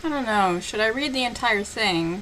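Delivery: usual speaking voice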